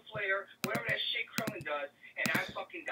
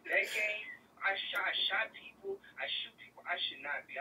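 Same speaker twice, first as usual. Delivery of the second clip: usual speaking voice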